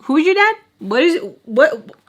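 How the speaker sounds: high-pitched, provoking questions; mouthy taunting. Retold or imitated. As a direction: needle them with pointed questions. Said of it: "I was talking to her like that".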